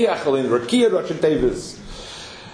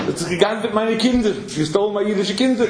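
put-on a touch higher, pleading to the Sultan over his children; mild pleading God; 5 semitones higher than usual. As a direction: claim the stolen children as a wounded father, lightly voiced.

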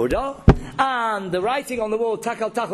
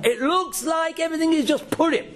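raised, high exclamation; emphatic outcry; 7 semitones higher than usual. Real, not acted. Rabbi stresses point to audience.

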